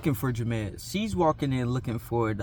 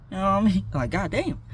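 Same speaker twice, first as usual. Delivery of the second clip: normal talking voice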